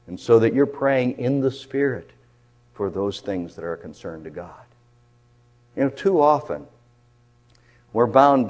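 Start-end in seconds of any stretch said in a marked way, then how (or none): none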